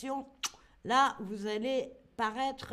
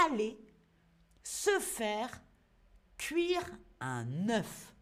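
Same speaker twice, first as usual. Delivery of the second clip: slow reveal; slower than usual. Shown as a demonstration. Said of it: "dévoile l'expression lentement".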